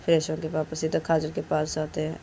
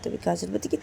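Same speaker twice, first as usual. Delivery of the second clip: plain reading voice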